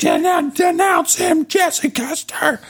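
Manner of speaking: high shouted denunciation; hollering. Retold or imitated. Ken Cannon hollering at Jesse to denounce God, high shout. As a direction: holler it at him from the pews. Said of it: "starts hollering to denounce God".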